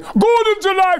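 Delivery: big high bellow of the catchphrase; shouted high Gordon's alive; Voltan's famous shout. Retold or imitated. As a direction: belt the line out like the film. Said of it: "would you mind saying Gordon's alive".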